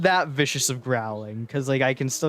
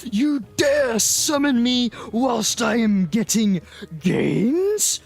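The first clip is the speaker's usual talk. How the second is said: booming demon lord; 9 semitones higher, much slower than usual. Acted. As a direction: roar it like an angry god. Gym notification rage, acted voice.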